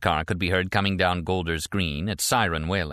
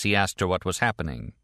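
plain reading voice

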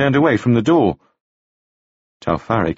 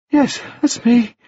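put-on much higher, bright boyish voice; high bright young gentleman; 13 semitones higher, slower than usual. A narrator's reading. Wounded Staines greeting Taufari, high and bright. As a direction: lift it up high and bright like a dazed young toff. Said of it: "speaking in a voice that was high and very bright".

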